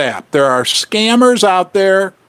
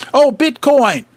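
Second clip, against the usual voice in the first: ordinary delivery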